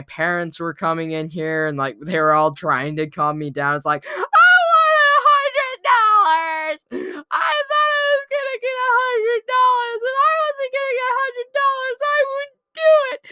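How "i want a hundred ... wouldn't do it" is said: put-on very high, loud wailing kid tantrum; crying tantrum scream. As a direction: scream and sob like a cheated child. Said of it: "So I screamed, and I cried".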